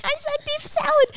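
high put-on friend voice; squeaky quote of her friend; imitating her friend. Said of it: "She's like".

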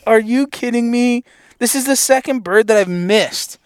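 high, frustrated complaint at himself; exasperated outburst. Retold or imitated. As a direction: vent at yourself after a miss. Angry at himself for missing.

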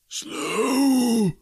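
high, drawn-out spectral cry; affronted ghost. A narrator's reading. Ghost repeating Scrooge's remark, wailing affront. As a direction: echo the word in haunted disbelief.

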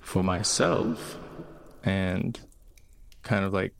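ordinary delivery

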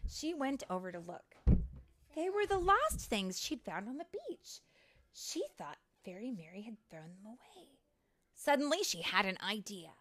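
animated storybook reading, building at the end; lively, expressive storytime voice; story narration for children. A narrator's reading. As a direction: read it to little ones, punching key words.

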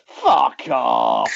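Scouse growl of a footballer mid-shot; mock Scouse swear as he shoots; thick Scouse accent. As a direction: spit it out in broad Scouse. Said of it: "in his thickest Scouse accent".

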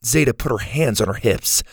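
ordinary delivery

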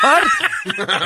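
high, loud squeal of disbelief; exaggerated incredulous yelp; mock shock. Dog owner reacting to an insult, mock shock.

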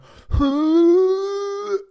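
long, loud, high squeal of pent-up frustration; held high-pitched "hooo" instead of shouting; demonstrated frustration noise. Shown as a demonstration. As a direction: let out a sustained comic squeal. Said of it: "I do this. I go".

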